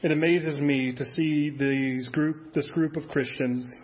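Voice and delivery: low, subdued praying voice; closing prayer. Real, not acted. Pastor praying to the Father for true devotion, hushed prayer. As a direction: pray softly and low, pausing between phrases.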